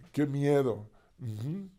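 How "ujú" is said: ordinary talk, nothing marked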